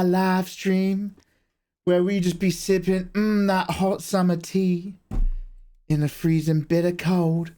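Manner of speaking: put-on slow, drawn-out Southern American accent. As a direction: lay on a folksy Southern American drawl. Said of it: "I wanna hear your best American accent".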